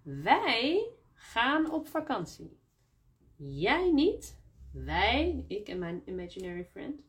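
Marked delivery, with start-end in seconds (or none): 0.0-2.6 wij lifted high and slowed; stress on wij. Shown as a demonstration. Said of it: "als het accent op dat woord is". 3.4-4.4 jij louder and higher, contrasting; stress on jij. Shown as a demonstration. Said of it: "accent op dat woord".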